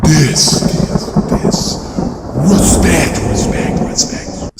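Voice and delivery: loud echoing repeat; booming echo fading out; echo effect. Pretend. Echoing a quoted catchphrase.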